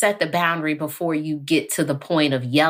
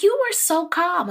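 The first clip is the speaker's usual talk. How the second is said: very high sing-song imitation; high cooing praise; mock admiring. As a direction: squeal it the way fans say it. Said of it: "when I say it, it's like".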